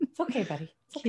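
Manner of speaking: calm the frightened animal gently; soothing a cat. Real, not acted. Calming a skittish cat.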